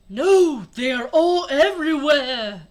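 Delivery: loud, drawn-out cry; panicking guy. A narrator's reading. Another guy warning of Combines everywhere, loud mock panic. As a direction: cry out in hammed-up alarm. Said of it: "another guy said".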